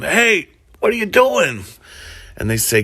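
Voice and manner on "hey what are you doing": high, called-out mock shout; retold shout. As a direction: call out across the yard in a hammed-up shout. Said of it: "George shouts out to him".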